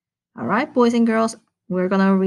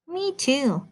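little mouse voice; 7 semitones higher, 5 dB quieter than usual. Acted. The field mouse speaks up.

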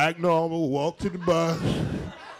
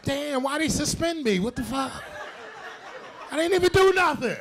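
whiny disbelief; 6 semitones higher than usual. Pretend. Crowd member mocked after suspension.

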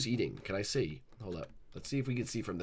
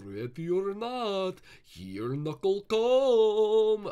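slow, drawn-out, much higher sing-song threat; menacing seeker taunt; 9 semitones higher, 6 dB louder, much slower than usual. Pretend. Knuckles warns the hiders he is coming, slow taunt. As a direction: stretch each word like a playful hunter.

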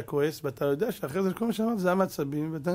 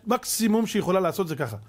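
ordinary delivery

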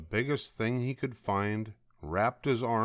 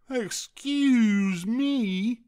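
high, slow, loud outraged rabbit; indignant shout; 14 semitones higher, 6 dB louder, much slower than usual. A narrator's reading. Rabbit grabbed by Douglas, drawn-out indignant shout. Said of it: "shouted the rabbit".

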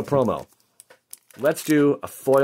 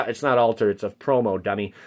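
ordinary talk, nothing marked

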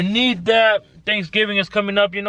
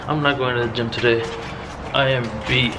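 normal talking voice

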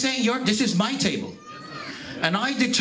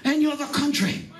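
higher, shouted gospel preaching with slow stretched calls; preaching at full force; 5 semitones higher than usual. Acted. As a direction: call out to the congregation like a revival preacher. Preaching climax, seats for every nation.

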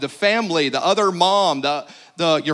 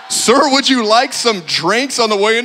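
raised, louder mock customer-service call; theater concession worker; 4 semitones higher, 5 dB louder than usual. Theater worker offers drinks to a sneaking customer, loud mock politeness.

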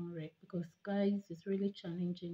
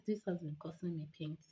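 usual speaking voice

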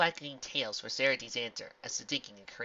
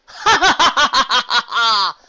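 slow, high, loud villain cackle; evil laugh; 13 semitones higher, 19 dB louder, slower than usual. Acted. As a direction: cackle with scorn. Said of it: "The Mayor of Darkness laughed".

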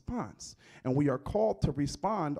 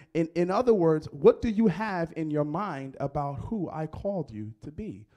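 ordinary delivery

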